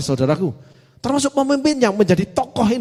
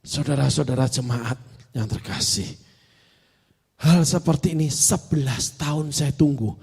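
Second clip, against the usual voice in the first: slow, low, subdued mock address; tearful pastor imitated; 5 semitones lower, 4 dB quieter than usual. Woman pastor addresses her congregation after weeping, subdued imitation. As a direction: speak softly and haltingly as a moved pastor. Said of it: "Terus dia bicara gini".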